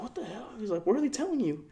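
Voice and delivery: put-on high kid voice, worried; little brother's voice. Ten-year-old brother asking his sibling, high worried voice. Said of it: "my brother's, like, ten".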